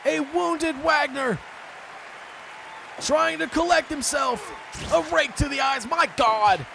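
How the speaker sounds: high-pitched live match commentary; excited wrestling play-by-play. Real, not acted. Commentator reacting to a rake to the eyes, excited. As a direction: call the blows as they land, voice lifted.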